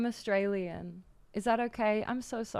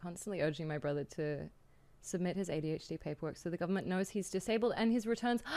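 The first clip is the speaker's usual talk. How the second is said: usual speaking voice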